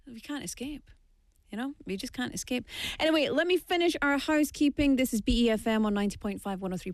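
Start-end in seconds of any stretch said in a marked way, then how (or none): 0.1-2.6 soft, resigned sigh of defeat; quiet mock gloom; mock despair. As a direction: play at hopeless surrender, much softer. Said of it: "mock resignation".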